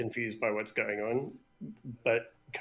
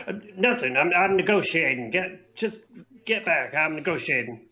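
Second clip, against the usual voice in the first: loud, high, defensive bluster; flustered denial; 4 semitones higher, 6 dB louder than usual. Acted. Captive leader insisting to his crew he's negotiating, loud and flustered. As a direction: cover panic with bravado. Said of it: "he immediately goes".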